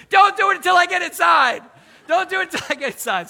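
high, loud panicked yell; daughter's shout. Retold or imitated. Daughter yelling at dad before he ends the squirrel's suffering, loud and high. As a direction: shout it while running off, fingers in ears. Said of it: "my daughter put her fingers in her ears, and she goes".

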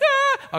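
put-on very high little-girl sad sigh; a tiny disappointed sigh from the room; child's sigh. Little daughter hearing the music turned down, high sad sigh.

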